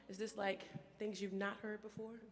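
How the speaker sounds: usual speaking voice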